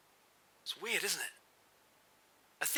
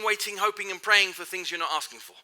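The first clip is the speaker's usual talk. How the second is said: ordinary delivery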